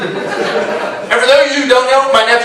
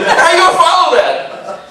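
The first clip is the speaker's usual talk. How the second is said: ordinary talk, nothing marked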